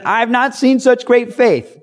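amazed exclaiming. Retold or imitated. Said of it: "and he said".

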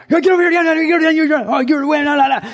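high, loud garbled barrage of orders; mock drill-sergeant yelling. Preacher imitates boot camp instructors descending on recruits, mock yelling. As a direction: yell over each other like drill instructors. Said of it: "they just start yelling at you right away".

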